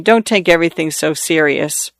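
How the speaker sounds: plain reading voice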